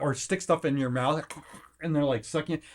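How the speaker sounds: ordinary talk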